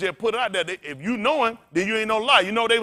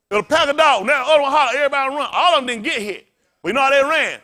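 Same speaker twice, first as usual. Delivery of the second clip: loud, rising sermon peak; preaching loud; 7 semitones higher, 8 dB louder than usual. Real, not acted. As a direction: drive it home, louder. Preaching at full force.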